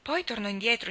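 ordinary delivery, nothing marked

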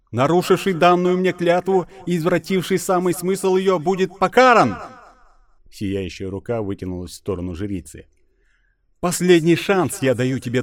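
loud, high divine wrath; booming goddess voice. Acted. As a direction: thunder it down as an angry goddess. (голос разгневанной богини)

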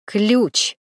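one word repeated with mild annoyance; irritated. A narrator's reading. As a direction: say it pointedly, a touch irritated. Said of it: "повторил собеседник с раздражением". (с раздражением, с нажимом)

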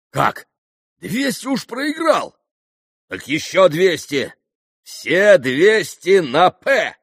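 high, loud reckless shout. A narrator's reading. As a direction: throw the bet out wildly. Mitya raising stakes. (громкий азартный крик)

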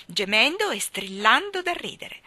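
ordinary delivery

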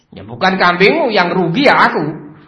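acted indignant outburst; angry boss. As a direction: protest as the aggrieved owner. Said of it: "Marah-marah dia".

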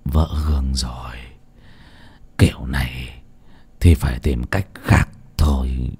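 low, hushed near-whisper; soft, low anxious undertone; worried. A narrator's reading. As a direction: murmur it softly, deeply troubled. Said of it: "khuôn mặt lo lắng".

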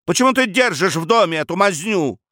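high-pitched, exasperated question; indignant demand. Acted. Son before his father's portrait, indignant.

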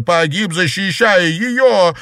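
high, loud cry, slow and stretched at the end; anguished shout. Acted. Grieving sister accusing her brother's beloved, anguished shout. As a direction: drag out the final accusing words.

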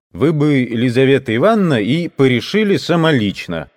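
loud, insistent tradesman. Acted. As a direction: press her loudly and eagerly. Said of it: "громко говорил мещанин".

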